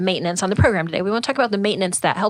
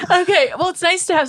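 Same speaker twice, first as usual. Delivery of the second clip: laughing; 11 semitones higher, 4 dB louder than usual. Real, not acted. Laughs at the boss joke.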